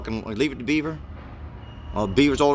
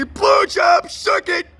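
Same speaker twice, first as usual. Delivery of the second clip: crude shouted catcall; 5 dB louder, much slower than usual. Retold or imitated. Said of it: "you can't be".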